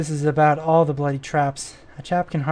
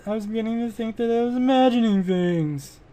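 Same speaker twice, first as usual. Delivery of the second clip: game character voice; 6 semitones higher than usual. Acted. Reading game dialogue in character.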